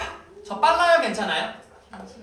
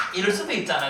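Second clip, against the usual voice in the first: ordinary talk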